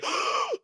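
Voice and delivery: a sharp, breathy gasp of shock at the price; mock shocked intake of breath. Shown as a demonstration. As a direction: gasp as if the contract numbers stunned you. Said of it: "I'm sure we're all gonna say".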